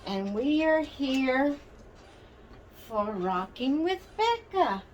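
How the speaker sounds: slow, high sing-song greeting with stretched words; sing-song show intro. Real, not acted. Host welcoming viewers to her rocking-chair show, sing-song.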